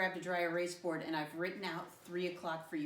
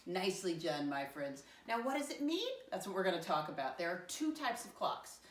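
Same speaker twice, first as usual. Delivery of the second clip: ordinary delivery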